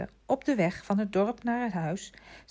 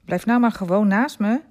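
firm, louder, higher instruction; resolute but friendly; 4 semitones higher, 10 dB louder than usual. A narrator's reading. Vitalis tells Remy to walk beside him, resolute yet friendly. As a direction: insist kindly, with clear force. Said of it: "zei hij resoluut, maar vriendelijk".

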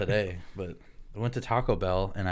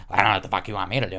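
fast, louder mock irritation; retold snapping; 5 dB louder, much faster than usual. Fast-food worker immediately snaps at a customer, retold irritation. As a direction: rattle it off like a fed-up worker. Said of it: "the woman immediately snaps to".

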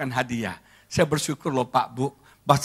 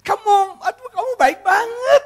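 high, teary squeal of thanks; hammed-up happy crying; mock tearful wife; 5 dB louder than usual. Wife thanking husband for gift, mock weepy high squeal. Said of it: "Dia nangis".

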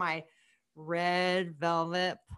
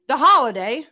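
loud announcement after a drum roll; proud, booming reveal; big reveal; 2 semitones higher, 10 dB louder than usual. Pretend. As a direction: punch the title like a winner.

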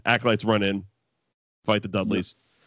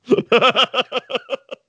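loud laugh; 12 semitones higher, 4 dB louder than usual. Real, not acted. Laughing hard at the memory.

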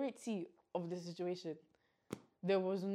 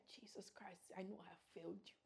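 soft unvoiced plea; whispered prayer; 3 semitones lower, 20 dB quieter than usual. Girl walking home begging God, whispered prayer. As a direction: confess it under the breath.

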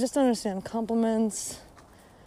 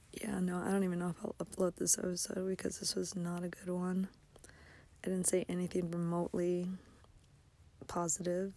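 whisper; 3 semitones lower, 12 dB quieter, faster than usual. Real, not acted. Disheartened vlogger muttering aside.

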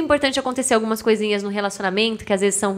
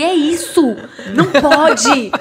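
laughing mock excitement; 4 semitones higher, 5 dB louder, slower than usual. Noivo entrando na paranoia dela.

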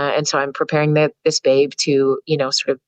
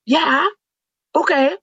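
high, happy agreement; father and son agreeing, high and eager; eager reply; 12 semitones higher, slower than usual. Retold or imitated. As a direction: answer like an excited kid. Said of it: "And they're like".

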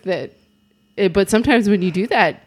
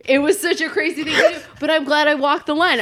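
laughing; 8 semitones higher than usual. Real, not acted. Laughing at own crazy story.

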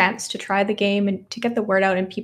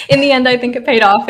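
amused and louder, fresh from a laugh; laughing while talking; 4 semitones higher, 8 dB louder than usual. Real, not acted. Guest looks back on the warm blanket recording, laughing. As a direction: chuckle into it, voice lifted and louder.

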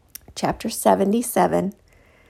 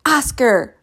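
higher, contained furious cry; furious scream; 5 semitones higher than usual. A narrator's reading. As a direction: call out the name in anger. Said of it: "Blue screamed in fury".

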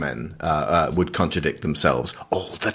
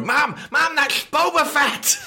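much higher, squealing mock kid; excited fanboy; slower than usual. Dork fan pointing at Boba Fett in the cinema, excited squeal. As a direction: play a nerdy kid tugging at his mom. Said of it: "just so dorks could go".

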